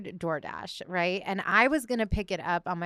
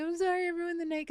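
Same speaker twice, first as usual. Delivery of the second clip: high, fast near-tears apology, much higher; tearful apology; 10 semitones higher, faster than usual. Retold or imitated. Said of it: "ready to cry".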